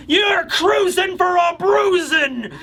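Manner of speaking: high, drawn-out angry-mom screech; hammed-up scolding mom, slow and high; mock screaming mother. Monster mothers of Elbaf threatening the giants, mock scream. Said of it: "mothers of Elbaf screaming like".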